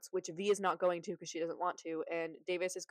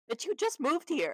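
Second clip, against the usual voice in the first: high, slow mock disbelief; incredulous sarcasm, pitched way up and slowed; sarcastic aside; 8 semitones higher than usual. Real, not acted. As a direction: drag it out higher to mock the line. Mocking the note's claim.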